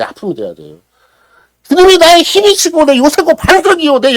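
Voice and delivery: loud, high impassioned preaching; loud high-pitched fervent sermon climax; preaching at full force. Acted. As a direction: pour it out to the congregation.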